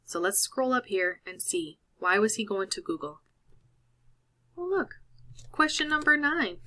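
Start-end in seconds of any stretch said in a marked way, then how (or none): 4.6-6.6 delighted discovery. Real, not acted. Teacher discovers answer.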